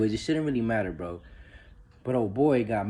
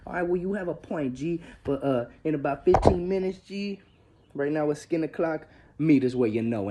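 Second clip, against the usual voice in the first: imitating the homies; 7 semitones higher, 5 dB louder than usual. Homies setting up the beating.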